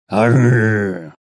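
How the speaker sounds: rolled, drawn-out growl; growl from above. A narrator's reading. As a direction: answer with a rolling beast-like growl. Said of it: "послышалось сверху".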